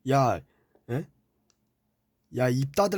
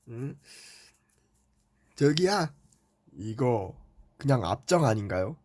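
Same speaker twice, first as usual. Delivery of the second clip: ordinary talk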